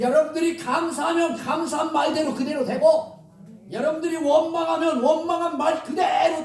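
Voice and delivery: preaching emphasis. Real, not acted. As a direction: drive the warning home to the congregation. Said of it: "preaching climax".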